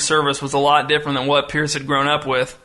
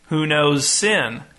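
slow question with "sin" raised, then falling; stress on SIN; much slower than usual. A narrator's reading. Pastor repeating his question from the pulpit, stressing "sin". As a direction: save the weight for "sin". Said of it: "rotating emphasis on each word".

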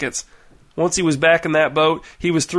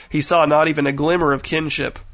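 ordinary delivery

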